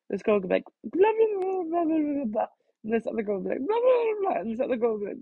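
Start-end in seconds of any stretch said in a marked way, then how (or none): 0.8-2.5 put-on high, babbling girly chatter; chattering girl voice. Imitating girls chattering to Sergeant. 3.7-4.4 put-on high, babbling girly chatter; chattering girl voice. Imitating another girl chattering.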